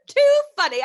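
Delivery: amused laugh into words. Real, not acted. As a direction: giggle through it. Laughing at story.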